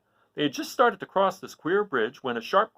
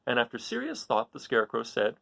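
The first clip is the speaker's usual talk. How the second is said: ordinary delivery, nothing marked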